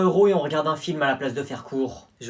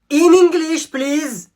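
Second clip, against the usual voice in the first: much higher, louder In English; English teacher shrieking at a pupil, much higher; shrill teacher voice; 12 semitones higher, 7 dB louder, much slower than usual. Acted. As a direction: screech In English like a fed-up teacher. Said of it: "avec ton English".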